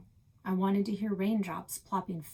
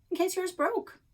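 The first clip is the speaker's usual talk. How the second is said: high, bright smug retort; smug kid voice; 11 semitones higher, 4 dB louder than usual. Acted. Quincy smugly answering Alex in a high kid voice. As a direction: toss it off like a know-it-all.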